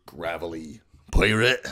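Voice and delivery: low gravelly pirate growl. Shown as a demonstration. Voice tutor demoing a gravelly pirate. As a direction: rasp it like an old pirate. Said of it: "kind of like Hagrid, a nice, low".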